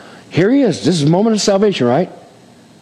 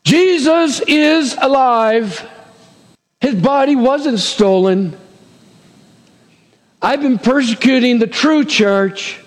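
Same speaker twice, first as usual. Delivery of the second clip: slow, awed thought, pitched up; Paul's realization, slow and lifted in awe; dawning realization; 7 semitones higher, slower than usual. Acted. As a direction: let it dawn on him, slowly.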